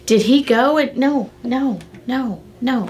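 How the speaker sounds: ordinary talk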